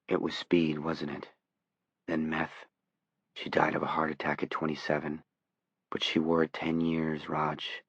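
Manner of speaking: soft, sorrowful murmur; quiet. Acted. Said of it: "Johnny spoke in his own voice again".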